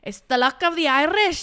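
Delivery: loud, high hammed-up Irish accent. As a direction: belt it out in an over-the-top Irish accent. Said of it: "Let me do it again".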